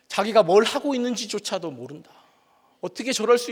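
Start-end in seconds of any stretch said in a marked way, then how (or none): none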